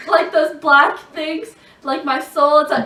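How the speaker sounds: soul-sucking gasp. Shown as a demonstration. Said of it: "I'm one of those people in Harry Potter".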